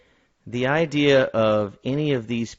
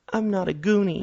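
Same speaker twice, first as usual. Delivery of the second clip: put-on high girlish voice; 9 semitones higher than usual. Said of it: "a sobering comment when she says".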